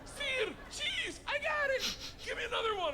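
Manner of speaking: overjoyed shouting to the boss; excited shout. Retold or imitated. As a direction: yell it like you just won a prize. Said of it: "And I was like".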